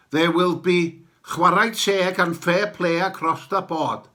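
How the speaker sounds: ordinary talk, nothing marked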